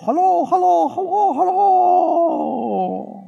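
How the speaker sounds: a high call that repeats and fades; echo voice. Retold or imitated. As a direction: play the canyon echo, fading. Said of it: "y el eco". (imitando el eco, cada vez más suave)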